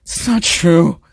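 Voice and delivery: exasperated outburst; aggravated. A narrator's reading. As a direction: burst out in irritated denial. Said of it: "Izuku visibly aggravated even more".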